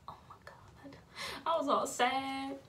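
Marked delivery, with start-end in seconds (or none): 0.1-1.0 hushed despairing whisper. Retold or imitated. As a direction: whisper it to yourself. Sad inner thought.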